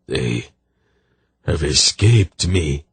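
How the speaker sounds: slow, broken groan through tears. Acted. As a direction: let the words fall apart, slowly and heavily. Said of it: "he groaned with tears welling".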